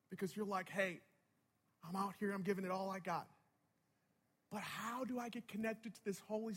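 soft, spaced-out sermon delivery; hushed reflective preaching. Real, not acted. Preacher offering to share his journey with listeners, hushed reflection. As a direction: confide it gently to the room.

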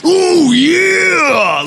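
loud, high wrestler catchphrase roar; big hammed-up wrestler shout; Macho Man Randy Savage. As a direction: stretch it out like a pro wrestler hyping the crowd. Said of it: "sounded like Macho Man Randy Savage".

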